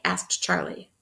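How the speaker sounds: ordinary delivery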